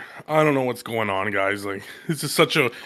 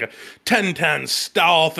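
ordinary talk

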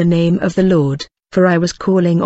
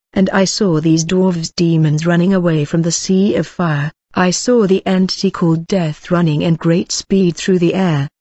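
usual speaking voice

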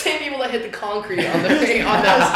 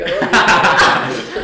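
drawn-out love you; 4 semitones lower, 5 dB louder, much slower than usual. Retold or imitated. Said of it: "I was like".

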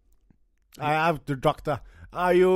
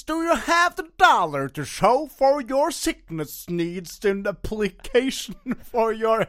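Texan doctor; 5 semitones higher than usual. Acted. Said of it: "I'm in Texas".

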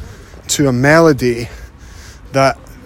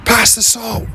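asked for the salt in a much higher, oddly off tone; strange tone; 9 semitones higher than usual. Shown as a demonstration. At the dinner table, asking someone to pass the salt, in an odd high tone. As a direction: make a plain request sound off by pitching it up high. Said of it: "that tone was a little bit strange".

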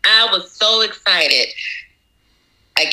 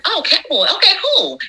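much higher, breezy mock-casual "Oh, cowboy. Okay, cool."; chipper own reply; 10 semitones higher, faster than usual. Cake baker recalling her own high carefree reply to the customer. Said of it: "And I was like".

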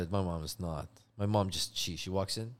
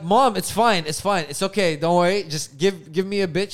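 pushy reassurance; 4 semitones higher, 6 dB louder than usual. Retold or imitated. Reassuring mom over the waitress.

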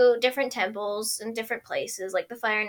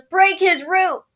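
calling out; 7 semitones higher, 8 dB louder, slower than usual. Retold or imitated. Iroh voiced calling.

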